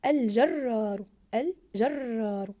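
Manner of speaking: "الجرار" very slow with a long rolled first ر; stressed doubled letter. Shown as a demonstration. Teacher modelling the doubled ra, stressed doubled letter. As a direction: trill and hold the doubled ر in "الجرار". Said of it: "ضاعفنا حرف الراء في كلمة".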